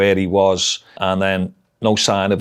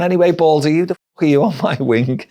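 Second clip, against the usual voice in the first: cocky challenge; 8 semitones higher, 3 dB louder than usual. Retold or imitated. Cheeky challenge to new governor.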